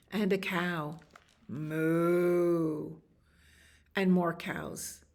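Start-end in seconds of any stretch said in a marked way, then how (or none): 1.5-2.9 long drawn-out moo; cow moo. Acted. Reader voices the cow arriving, long moo.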